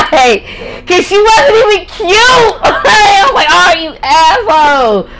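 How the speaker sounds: high-pitched laughing indignation; mock outrage, high and cracking up; laughing outburst. Real, not acted. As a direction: squeal it through laughter. Laughs at her own old jealousy.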